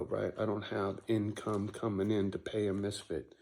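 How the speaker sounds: ordinary talk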